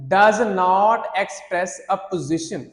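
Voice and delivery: each word drawn out very slowly; slow dictation. Shown as a demonstration. Teacher reading the band criterion to students, very slow. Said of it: "उसकी दूसरी condition ये है".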